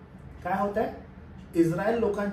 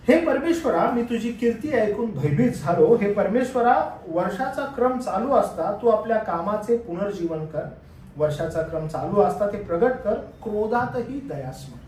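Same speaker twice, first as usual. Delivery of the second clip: raised, ringing Bible reading; scripture reading; 5 dB louder than usual. Acted. Preacher reads prophet's prayer to church, raised and ringing. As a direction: proclaim the prayer to the congregation.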